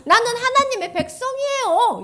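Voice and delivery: high, laughing mock boast; mocking smugness. Teacher mocks unfaithful people with a loud laughing boast. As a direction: sneer it out like a smug hypocrite.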